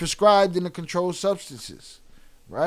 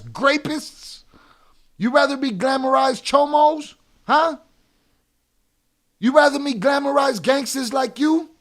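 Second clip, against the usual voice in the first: higher, louder, mocking challenge; angry taunting; 7 semitones higher, 7 dB louder than usual. Real, not acted. Streamer taunts G Money in chat, angry and rising. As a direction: bait him, voice climbing.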